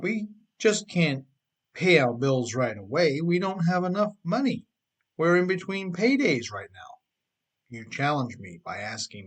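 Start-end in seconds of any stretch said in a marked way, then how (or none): 0.0-7.0 higher, louder whiny protest from the reader; voicing broke readers who can't pay; mock complaining listener. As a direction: play the excuse-making reader, pleading. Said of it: "You are thinking ... explaining to me".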